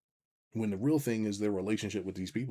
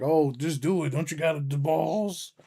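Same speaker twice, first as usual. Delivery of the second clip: mock taunt; 6 semitones higher, 4 dB louder, slower than usual. Mocking people pushing him.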